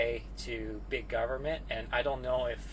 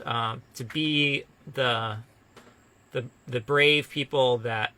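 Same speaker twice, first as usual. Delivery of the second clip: earnest closing speech; 7 dB louder than usual. Acted. Earnest closing Easter message.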